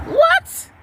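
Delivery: high-pitched, outraged double-take; incredulous. Acted. Mother hearing "I hate you" from kids, shrill-high disbelief.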